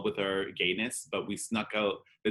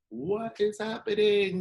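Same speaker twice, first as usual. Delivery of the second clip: much higher, slow, awestruck; amazed; 13 semitones higher, 4 dB louder, slower than usual. Retold or imitated. Seeing a drag queen perform for the first time, awestruck and much higher. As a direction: gasp it out like you can't believe your eyes.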